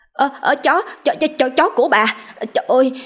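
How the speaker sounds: high, fast, frantic hollering; shrieking. Acted. The fat woman shrieks after the thieves stealing her dog, high frantic wail. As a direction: wail to the whole village for help. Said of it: "thì gào lên hô hoáng".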